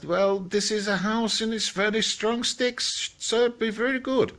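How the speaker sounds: high-pitched piggy boasting of his house; second little piggy. Acted. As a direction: pipe up like a confident little pig. Second pig reassuring, high voice.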